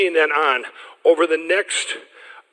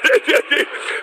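real laugh; 10 semitones higher than usual. Real, not acted. Laughs recalling meeting Trump.

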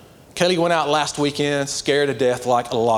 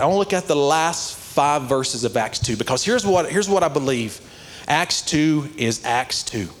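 ordinary talk

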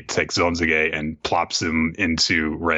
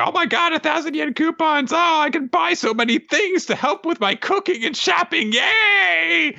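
very high squeaky excited character voice; thrilled high-pitched cartoon cheer. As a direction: gush giddily in a high put-on squeal. Excited character quote in manga recap.

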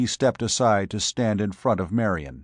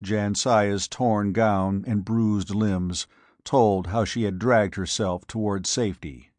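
ordinary delivery, nothing marked

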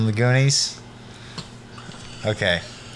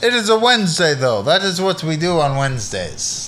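vampire playing dumb; 2 semitones higher than usual. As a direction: feign innocence as the vampire. Vampire explaining Wednesday routine.

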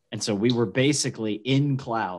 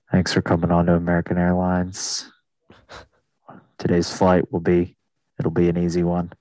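lower, even captain's cabin announcement; airline pilot; 5 semitones lower than usual. Retold or imitated. Mocking how every pilot in America sounds, low pilot voice. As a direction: imitate a calm pilot on the PA. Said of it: "why does every pilot in America talk like".

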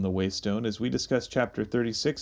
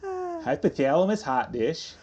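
slow, higher Midwestern twang; mock Minnesota accent; 4 semitones higher than usual. Co-host presenting hot dish as a joke, mock Minnesota accent. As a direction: offer it up like a Minnesota potluck dish. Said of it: "without it sounding like you're going to then present it with".

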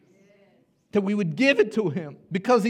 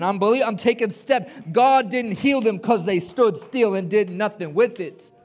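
loud, high, fervent preaching climax; rising revival preacher; preaching at full force; 6 semitones higher, 6 dB louder than usual. Acted. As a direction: drive the congregation to move, slow and loud.

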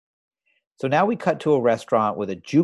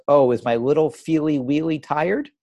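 raised, louder mock baby talk; Mitzi's baby talk; 6 semitones higher, 5 dB louder than usual. Podcast host imitating Mitzi teasing Felix, raised cooing baby talk. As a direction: coo it like a childish girlfriend. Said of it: "Mitzi says".

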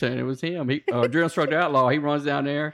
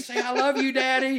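higher instructor voice with mock crying; mock weepy order; 10 semitones higher than usual. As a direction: order it in a mock-tearful whine.

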